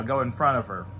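ordinary talk, nothing marked

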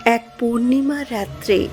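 ordinary delivery, nothing marked

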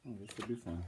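plain reading voice

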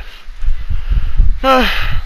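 long breathy worn-out sigh; tired sigh. Real, not acted. Up at three in the morning, a tired sigh. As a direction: let out an exhausted sigh. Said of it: "I can't keep my eyes open".

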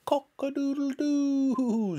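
high, drawn-out rooster crow; squawking cockerel. A narrator's reading. Cockerel shooting up into the air, squawking crow. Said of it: "squawked the cockerel".

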